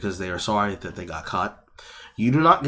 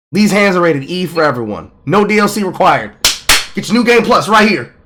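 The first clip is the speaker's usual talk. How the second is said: higher, louder mock ad pitch; joking hype about fighting; hyped-up joke; 7 semitones higher, 8 dB louder than usual. Real, not acted. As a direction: sell it like a game trailer.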